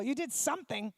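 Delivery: normal talking voice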